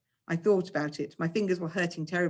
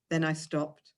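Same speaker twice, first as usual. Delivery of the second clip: ordinary delivery, nothing marked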